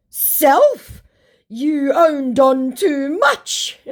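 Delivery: slow, loud, high scammer impression; mock angry scammer. Scammer insulting the baiter back, mock angry. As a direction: drag out the insult in a put-on voice.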